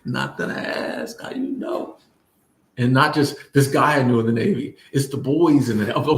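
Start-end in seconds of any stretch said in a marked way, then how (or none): 0.0-2.0 slow, breathy mock-secret whisper, pitched high; teasing whisper. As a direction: lean in and whisper it like a juicy secret. Teasing repeat of the same line.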